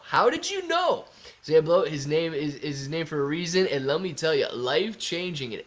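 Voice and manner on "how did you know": mock surprise. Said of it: "repeats the question, much higher".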